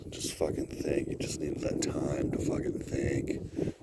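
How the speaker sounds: breathy whispered self-talk; hushed whisper to himself. Real, not acted. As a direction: whisper it to yourself.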